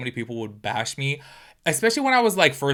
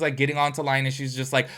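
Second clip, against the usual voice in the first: ordinary delivery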